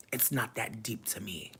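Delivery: usual speaking voice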